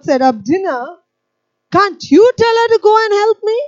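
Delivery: high, loud indignant complaint. Retold or imitated. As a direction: demand that Jesus scold her sister. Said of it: "She says".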